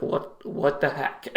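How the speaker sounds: slow, breathless whisper; patient muttering to himself in a slow whisper; whispered disbelief. Retold or imitated. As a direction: hush it out in shock. Stunned disbelief at admission.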